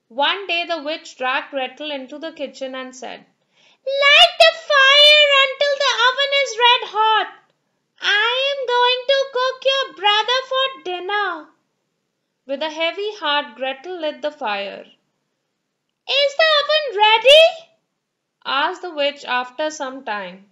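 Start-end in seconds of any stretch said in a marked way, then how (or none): 4.0-11.5 high, loud commanding witch voice; bossy witch. Acted. Witch orders Gretel. 16.2-17.4 high, loud, fast witch question; impatient witch. Acted.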